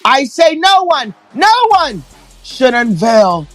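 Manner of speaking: slow, hammed-up old-fashioned stage voice; dramatic theatrical parody. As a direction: overact it like a melodrama heroine. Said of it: "And in scene".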